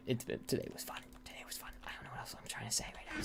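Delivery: hushed, breathy whisper; real late-night whisper. Real, not acted. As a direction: drop into a whisper mid-thought. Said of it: "Oh, why I'm whispering".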